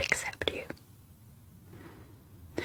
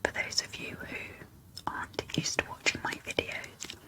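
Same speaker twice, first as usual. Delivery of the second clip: asmr whisper. Real, not acted. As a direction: whisper every word close and gentle. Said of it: "in an A S M R whisper".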